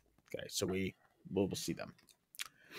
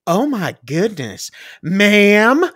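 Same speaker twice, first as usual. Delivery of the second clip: slow, then louder and higher on ma'am; a gasp of shock at the end; shocked operator; 7 semitones higher, 6 dB louder, much slower than usual. Retold or imitated. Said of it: "the phone operator go".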